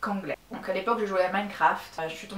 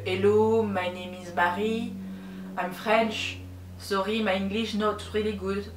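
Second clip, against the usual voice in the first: nervous beginner stumbling through a self-introduction; broken French-accented English; slower than usual. Retold or imitated. Introducing herself to American gamers, shy French-accented English. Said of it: "c'était ça mon niveau à l'époque".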